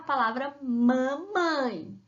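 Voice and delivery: "mamãe" drawn out as ma-mãe; syllable by syllable. Shown as a demonstration. Teacher giving pupils the word to find, syllables drawn out. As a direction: stretch both syllables of "mamãe". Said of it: "procurar as sílabas pra formar".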